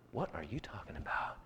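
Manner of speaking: hushed, breathless disbelief; whisper. Retold or imitated. Boy whispering to his dad in disbelief. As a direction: whisper it in shock. Boy reacts to father's offer.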